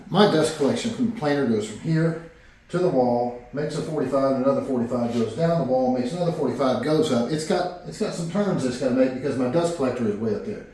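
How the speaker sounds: ordinary talk, nothing marked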